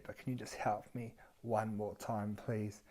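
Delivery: soft, small-voiced begging; acted timid request for a favour; sheepish plea. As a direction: drop to a meek, quiet plea. Sheepish repeat plea for help.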